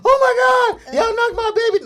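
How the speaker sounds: high, loud mock hysterical cry; mock panicked parent. Father mocking a parent who panics over the fall. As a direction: parody a frantic mother.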